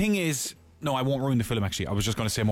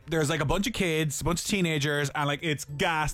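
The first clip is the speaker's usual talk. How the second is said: normal talking voice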